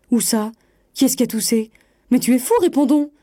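crying out in exasperation; exasperated. A narrator's reading. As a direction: demand an answer, voice climbing. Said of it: "s'écria, exaspérée".

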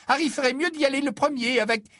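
very high, loud and fast fussing mother; high rushed motherly voice; hurried Mrs Weasley. Acted. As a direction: press on quickly, flustered.

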